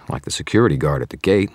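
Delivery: plain reading voice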